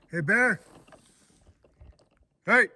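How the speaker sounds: real loud shout at a bear; scaring off a bear. Real, not acted. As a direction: yell high and loud to scare it. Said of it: "I was shouting out".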